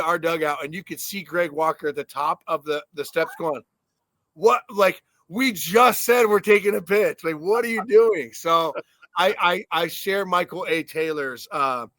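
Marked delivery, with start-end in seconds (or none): 4.3-8.3 mock disbelief. Hitting coach at the dugout steps, in hammed-up disbelief. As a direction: throw your hands up at the hitter.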